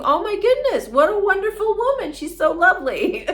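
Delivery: high, loud, fast gushing praise; gushing delight. Retold or imitated. Leaving the helped coworker, gushing high and loud. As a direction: swoon over how lovely she is. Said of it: "you would leave thinking".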